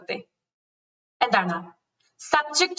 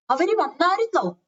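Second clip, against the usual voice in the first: lightly acted, higher-pitched enquiry; acted question; 7 semitones higher than usual. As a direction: ask it as a curious neighbour would. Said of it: "എന്നൊക്കെ ചോദിക്കത്തില്ല".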